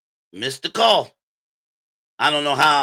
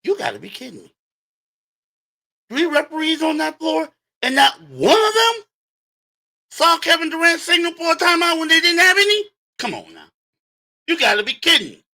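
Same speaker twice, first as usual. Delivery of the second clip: incredulous outrage; 8 semitones higher than usual. Retold or imitated. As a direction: rant at the refs like you can't believe it. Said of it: "And I'm thinking".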